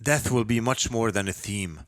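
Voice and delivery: usual speaking voice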